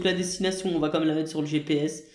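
usual speaking voice

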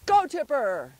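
loud, high send-off with falling tail; shouted command. Real, not acted. Owner launching dog on a fetch, shouted command. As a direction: shout the dog away after a ball. Sending dog after the ball.

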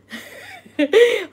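a sudden high burst of laughter; laughing. Real, not acted. Laughing at a mosquito buzzing around during her live. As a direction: laugh out loud, caught off guard.

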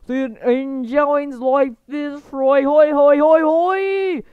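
hammed-up accented chant; Bert. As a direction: sing it out in an overdone accent, ending in hoys. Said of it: "You sound like Bert".

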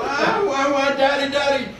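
put-on high, whining baby wail; crying baby. Baby son wailing at his father over his food. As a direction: wail like a fussy infant for daddy.

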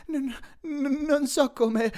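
high, gasping stammer; shaken, halting and out of breath; panting. A narrator's reading. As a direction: stammer it out while winded. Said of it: "ansimò".

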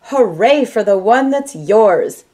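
ordinary delivery

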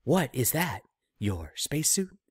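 lightly mocking Shatner jab. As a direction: tease the costume like a smug star. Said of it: "he said".

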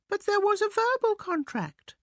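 very high-pitched mouse protest. A narrator's reading. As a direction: object in a high little mouse voice. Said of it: "protested Guru".

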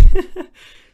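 giddy squeal of laughter at a dumb joke; high squeal laugh. Real, not acted. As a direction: burst into a shrieking giggle. Laughing at sixty-nine joke.

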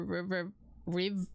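ordinary talk, nothing marked

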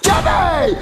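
high, sharp call of the name; alarmed parental yell; scolding shout. Acted. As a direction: shout it to stop a child. Dad catches child breaking resolution.

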